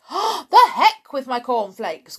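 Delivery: loud, high outburst; impatient exclamation; dismissive cry. A narrator's reading. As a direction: burst out, brushing off the nagging. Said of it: "cried Mister Cranky".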